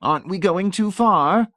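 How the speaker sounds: high, loud, gleeful question; heaving with merriment. A narrator's reading. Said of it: "heaving with merriment".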